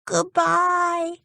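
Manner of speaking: a boy's mild mock shout of "Goodbye". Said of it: "shouted Dick".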